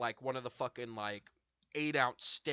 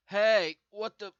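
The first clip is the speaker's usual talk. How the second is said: loud; 7 semitones higher, 10 dB louder, slower than usual. Retold or imitated. Calling work mid-illness.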